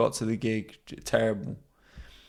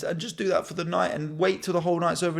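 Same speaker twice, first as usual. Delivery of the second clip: ordinary talk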